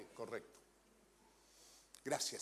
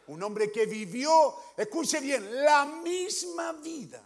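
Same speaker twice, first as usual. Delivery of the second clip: louder, higher and slow, pressing each word; emphatic preaching; 7 semitones higher, 9 dB louder, much slower than usual. Real, not acted. Preacher urging the congregation to listen, slow emphatic build. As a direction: drive the point home. Preacher stresses Christ's life.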